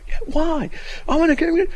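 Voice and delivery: high, loud mock yell; imitated yelling. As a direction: imitate a heated back-and-forth shouting match. Acts out the yelling exchange.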